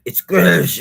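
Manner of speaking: a loud German accent on "It's good"; jokey German accent, belted out; loud mock German accent. Shown as a demonstration. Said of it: "Say it German".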